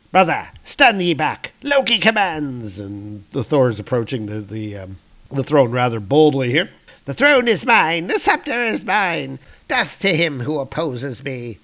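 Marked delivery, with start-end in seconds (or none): 0.1-2.8 high, theatrical order; commanding villain. Acted. Loki ordering his approaching brother back, high and imperious. 7.1-11.6 high-pitched, theatrical outburst; possessive villain. Acted. Loki defending his throne from brother, high possessive outburst.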